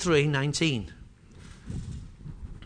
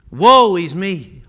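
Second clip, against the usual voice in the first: cry of woe; 2 semitones lower, 8 dB louder, slower than usual. Acted. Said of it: "I cried".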